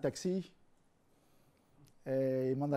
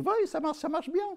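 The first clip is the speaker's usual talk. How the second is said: voiced seller; 12 semitones higher than usual. Hot dog seller answers.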